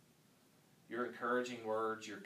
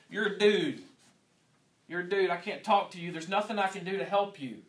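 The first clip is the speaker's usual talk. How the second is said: mock loud refusal; 7 semitones higher, 11 dB louder than usual. Mocking a student pastor refusing.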